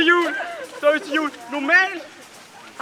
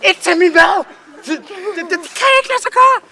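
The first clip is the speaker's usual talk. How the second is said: loud, high indignant yelling; hammed-up fury about fruit; mock outrage; 8 semitones higher, 8 dB louder than usual. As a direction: rant like you've been personally wronged. Ranting about fruit in food.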